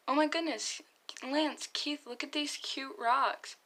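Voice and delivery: high, bubbly squeal of delight; gushing excitement. Acted. Hunk showing Lance and Keith cute rocks, high excited gushing. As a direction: gush like a kid finding treasure.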